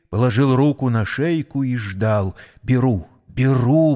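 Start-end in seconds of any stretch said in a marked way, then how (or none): 2.6-4.0 understated, insistent repetition; insistent repeating. A narrator's reading. Числов waits with a hand on Mary's neck, repeating insistently. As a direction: repeat it firmly, barely raising the voice. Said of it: "повторял он".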